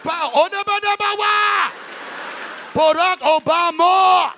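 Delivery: high, loud African chant; mock African accent. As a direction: boom it out in exaggerated African accent. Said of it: "That was Barack African side".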